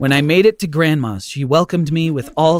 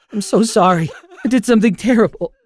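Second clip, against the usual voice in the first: higher, choked-up tearful pleading; a boy confessing through tears, voice high and strained; tearful confession; 9 semitones higher, 5 dB louder than usual. Acted. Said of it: "The tears started flowing again".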